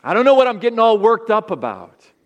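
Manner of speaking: a loud, high tempter coaxing them to relax; dismissive tempter voice. Acted. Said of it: "or maybe".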